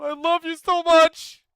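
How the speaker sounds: swooning, over-the-top declaration; gushing love shout. Acted. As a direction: belt it out like a devoted fan. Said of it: "so I will say it".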